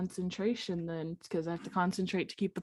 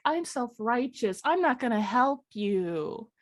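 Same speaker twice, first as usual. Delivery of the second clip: hammed-up holier-than-thou unicorn; mocking unicorn impression; 5 semitones higher than usual. Daughter grumbles about the uppity unicorn, mockingly.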